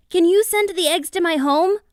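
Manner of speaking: little ostrich shouting to the beavers; brave cry. A narrator's reading. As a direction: shout out bravely to protect them. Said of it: "the ostrich cried".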